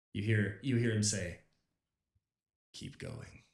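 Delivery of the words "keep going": very low, soft, ominous voice; deeper voice. Acted. Game master as a voice in a player's head, very deep. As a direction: drop to the very bottom of your range, softly. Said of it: "you hear a deeper voice".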